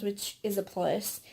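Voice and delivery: normal talking voice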